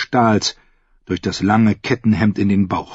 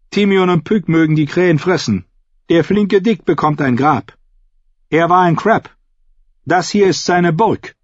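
raised, higher, emphatic pronouncement; stern Brienne; 9 semitones higher than usual. A narrator's reading. Brienne tells the fool who gets buried, stern and firm. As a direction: lay down the decision firmly.

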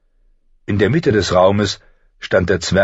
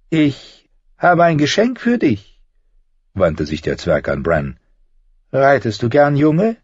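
higher, sly and needling; a higher, sardonic little man's voice; mocking dwarf Tyrion; 8 semitones higher than usual. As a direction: talk down to a would-be lord with cool mockery.

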